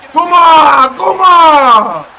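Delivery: high, loud, drawn-out victory roar; triumphant shouting. Real, not acted. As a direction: bellow it out like a fan at the winning moment. Cheering the winning play.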